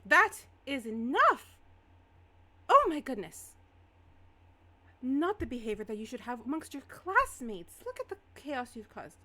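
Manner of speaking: loud, high-pitched outraged teacher; stern scolding. Acted. Teacher breaking up a fight between classmates, stern scolding.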